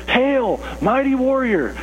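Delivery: high, ringing herald's call, a touch mocking; mock-grand greeting to a scared man; grand proclamation. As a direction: hail him like a herald in jest. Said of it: "someone comes to you and says".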